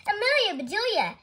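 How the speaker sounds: high, loud call of greeting; relieved greeting. A narrator's reading. Nurse Ames greets Amelia in the noisy office, calling out. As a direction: call the name out high and gladly.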